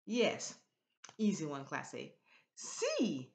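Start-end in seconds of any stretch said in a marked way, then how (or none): 2.6-3.3 "Sí" pitched up high and held; accented, raised "Sí"; voice goes up. Shown as a demonstration. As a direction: lift the pitch firmly on "Sí". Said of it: "your voice goes up".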